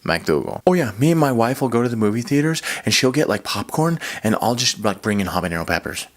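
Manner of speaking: low put-on guy voice; made-up coworker voice. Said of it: "that's not at all how he sounds".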